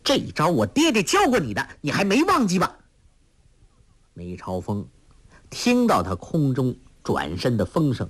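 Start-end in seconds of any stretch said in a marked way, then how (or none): 0.0-2.8 high, fast, taunting call; a girl taunting her opponent mid-leap. A narrator's reading. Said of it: "叫道".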